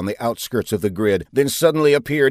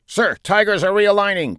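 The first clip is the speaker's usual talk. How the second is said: higher-pitched eager captain; 11 semitones higher, 4 dB louder than usual. A narrator's reading. Squadron captain reporting to his commander, eager. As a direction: report keenly over the radio. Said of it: "Captain Mitrius's eager voice".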